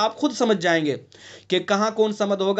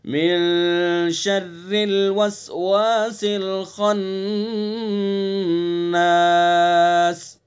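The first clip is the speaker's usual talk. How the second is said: slow chanted recitation, खन्नास long; Quran recitation; 2 semitones lower, much slower than usual. Shown as a demonstration. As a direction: recite with खा full-mouthed. Recites the verse. (धीमी तिलावत)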